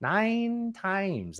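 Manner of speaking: high, stretched movie-quote voice; Ferris Bueller quote. Retold or imitated. Co-host quoting the film principal about absences, drawn-out imitation. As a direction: draw out both words, pitched well up. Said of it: "That's from Ferris Bueller".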